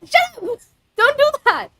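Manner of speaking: flustered squeal. Real, not acted. Reacting to chat, flustered.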